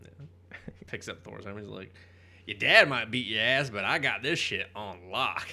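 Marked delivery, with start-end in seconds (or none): 2.5-5.5 loud, cocky comic-book boast; big boast, slowing at the end; mock boast. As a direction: swagger it out loudly, playing the character. Voices Hammer character boasting.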